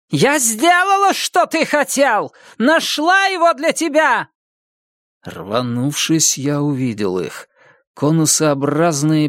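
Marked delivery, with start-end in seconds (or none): 0.1-4.3 deafening scream. Acted. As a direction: scream it up to the sky. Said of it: "оглушительно заорала куда-то вверх".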